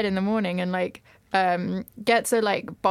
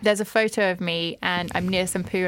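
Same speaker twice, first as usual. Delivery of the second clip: normal talking voice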